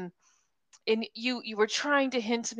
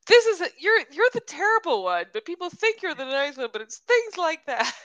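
louder, higher mock accusation; imitating co-host's complaint; 6 semitones higher, 6 dB louder than usual. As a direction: mimic your friend's indignant protest. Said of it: "and B's like".

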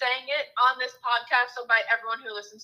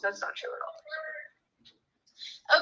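quiet off-mic aside; speaking away from the mic; turning away from phone; 6 dB quieter, faster than usual. Real, not acted. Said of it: "Sorry, that was my mom".

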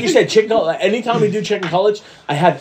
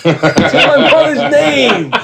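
higher, slow, laughing mobster line; movie gangster quote; 7 semitones higher, slower than usual. Retold or imitated. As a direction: quote the gangster slowly, cracking up. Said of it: "my favorite De Niro line".

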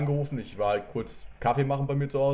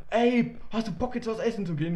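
high-pitched imitation of an eager caller; mock-enthusiastic friend ringing up; eager buddy impression; 7 semitones higher than usual. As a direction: call out like a pal on the phone. Imitates buddy phoning with an invitation.

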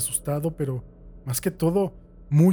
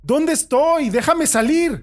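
much higher, louder frantic shout; angry demand; 12 semitones higher, 8 dB louder, much slower than usual. Acted. Prisoner shouts at his captor through the vent, frantic anger. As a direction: demand your freedom at full pitch.

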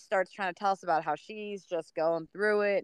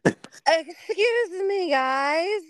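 high, drawn-out excuse me; tipsy lady; 12 semitones higher, 4 dB louder, much slower than usual. Retold or imitated. Drunk neighbor lady interrupting the runners, high and drawn out. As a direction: call out like a slightly drunk neighbor. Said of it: "this lady comes out from her yard".

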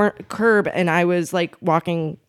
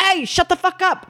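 higher, brash mock tough-guy snap; tough Chicagoan; 10 semitones higher than usual. Chicago locals who pride their rough edges, mock brash snap. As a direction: play the rough-edged local telling you off. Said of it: "they will be like".

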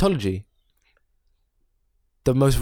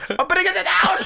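mock panicked voice.

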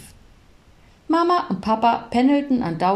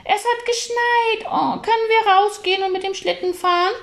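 put-on boy thrilled at the first snow; excited shout; 13 semitones higher than usual. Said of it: "schrie Paul plötzlich los".

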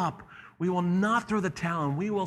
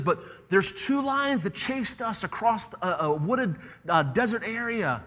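put-on higher-pitched childlike objection; protesting boy; 6 semitones higher than usual. The boy argues with the lion about the chase, protesting. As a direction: argue back in a high, stretched-out voice.

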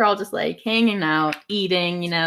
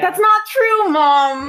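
loud protest; 9 semitones higher, 6 dB louder, much slower than usual. Real, not acted. Daughter protests loudly.

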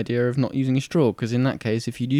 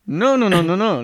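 broken English barman; 7 semitones higher than usual. Retold or imitated. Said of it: "It is broken English".

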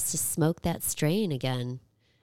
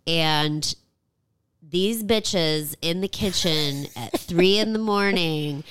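ordinary delivery

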